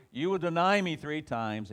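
forceful prediction. Acted. Jesus foretelling Peter's denial, forceful pronouncement. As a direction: declare it high and strong.

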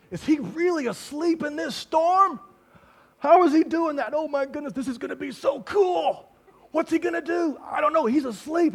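high-pitched hammed-up amazement; excited, unafraid. Disciples as water comes into the boat, excited. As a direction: act out giddy thrill, opening on a loud whoa. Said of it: "They're excited and not afraid".

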